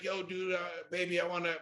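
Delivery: mumbled, lazy imitation of a clumsy pickup; lazy mock come-on. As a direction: toss it off like a half-hearted hookup line.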